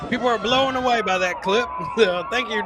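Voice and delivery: normal talking voice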